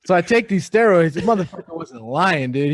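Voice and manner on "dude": ordinary delivery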